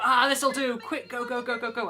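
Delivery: high, hurried self-quote; retold frantic rush. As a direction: act out scrambling to finish in time. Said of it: "we're rushing around the house trying to finish".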